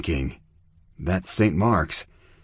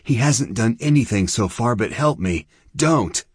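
urgent plea, pitched high; begging; 6 semitones higher than usual. A narrator's reading. Chia defending Gomi Boy from Zona's blade, begging. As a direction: beg her to stop. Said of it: "Chia begged".

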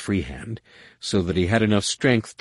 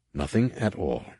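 usual speaking voice

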